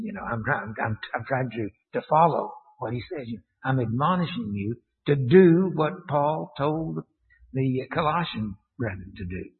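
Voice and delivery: ordinary talk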